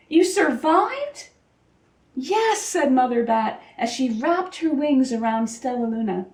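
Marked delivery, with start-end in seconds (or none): 0.1-2.6 amazed. A narrator's reading. Joyful reunion of mother and baby.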